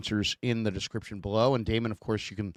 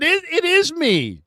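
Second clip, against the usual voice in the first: high, louder, slow mock reply; mock answer; 5 dB louder, slower than usual. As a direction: answer the fan in a hammed-up voice. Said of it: "And I said".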